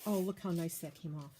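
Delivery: normal talking voice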